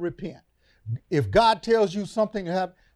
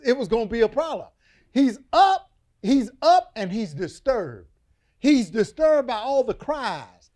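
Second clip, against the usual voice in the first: loud, rising preacher's cadence; full-force preaching; 6 semitones higher, 5 dB louder than usual. Acted. Preacher driving home God's final judgment, loud. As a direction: preach it hard to the congregation.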